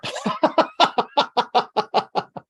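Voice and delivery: long, high burst of laughter; hearty laugh. Real, not acted. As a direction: laugh out loud at a joke. Laughing at dog-software cat joke.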